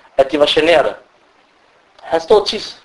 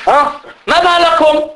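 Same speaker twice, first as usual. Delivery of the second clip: shouting in prayer; 10 semitones higher than usual. Retold or imitated. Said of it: "Råber og skrig i salat".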